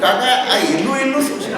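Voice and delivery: high, loud naive question with a laugh. Retold or imitated. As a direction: ask it with laughter bubbling up. Asking uncle, laughing. (હસતાં હસતાં ભોળો પ્રશ્ન)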